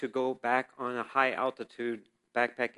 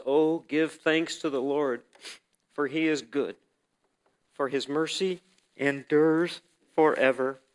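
slow, louder, proclaiming bible reading; scripture reading; 4 dB louder than usual. Acted. Pastor reads First Chronicles to the church, proclaiming. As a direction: read it out as holy proclamation.

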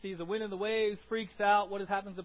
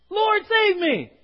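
high, loud, slow cry for help; anguished cry; 10 semitones higher, 15 dB louder, much slower than usual. Acted. Preacher voicing Peter going under, a loud desperate cry.

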